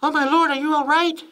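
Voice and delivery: high falsetto alarm of a wife. A narrator's reading. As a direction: cry out in falsetto, worried for your husband.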